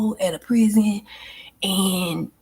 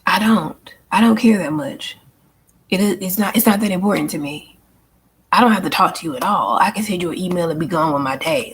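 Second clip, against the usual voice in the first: dismissive brush-off. Retold or imitated. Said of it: "I'm like".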